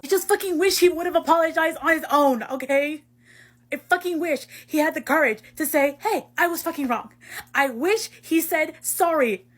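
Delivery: loud, high, wounded outburst; upset venting. Acted. Hurt friend wishing for a real apology, upset venting. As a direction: push it out, hurt and heated.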